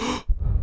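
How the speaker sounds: sharp acted gasp; sudden frightened intake of breath. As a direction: gasp in shock. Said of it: "Monty gasped".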